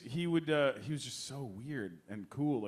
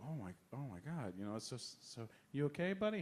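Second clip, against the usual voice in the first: much softer, worried hush; hushed concern; 2 semitones lower, 8 dB quieter than usual. Retold or imitated. Comforting a teary co-star in a soft hush. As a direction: lean in and check on him quietly. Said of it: "I'd go".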